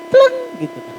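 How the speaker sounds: a sudden high pinging "Pleng"; pleng sound effect. Retold or imitated. Pastor mimicking coffee waking his brain, a sharp high ping. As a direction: make the ding of an idea lighting up.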